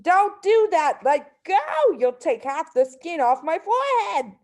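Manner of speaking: high, loud pained protest; screaming. A narrator's reading. Husband yelling at wife yanking his hat, loud. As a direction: yell at her to let go. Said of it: "he screamed".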